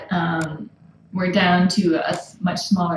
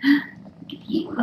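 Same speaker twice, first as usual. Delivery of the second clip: whisper; 5 semitones higher, slower than usual. Real, not acted. Whispering to purring kitten.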